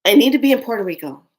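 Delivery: urgent insistence. Retold or imitated. Urgent plea after death news.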